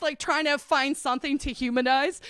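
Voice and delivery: ordinary talk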